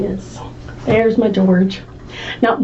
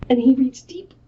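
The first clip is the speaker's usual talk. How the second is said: plain reading voice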